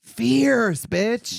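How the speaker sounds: high, loud drag cheer; campy cheer. Real, not acted. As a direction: shout it like a drag fan.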